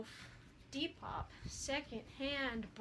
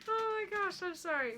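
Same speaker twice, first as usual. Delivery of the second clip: high and loud through laughter; laughing; 8 semitones higher, 5 dB louder than usual. Real, not acted. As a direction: gasp it out mid-laugh. Still laughing.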